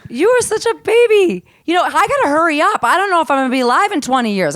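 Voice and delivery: high, loud playful ribbing, then rushing on; teasing. Real, not acted. Teasing her younger comedian friend, playfully high and loud.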